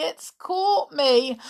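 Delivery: slow, fading tearful repeat; slow tearful whimper. Acted. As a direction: let the sob run down. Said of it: "wept Amelia".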